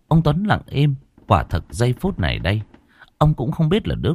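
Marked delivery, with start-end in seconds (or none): none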